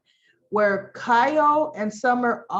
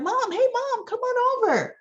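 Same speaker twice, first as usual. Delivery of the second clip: much higher, bright call to her mom; daughter calling out. Retold or imitated. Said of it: "So Summer's like".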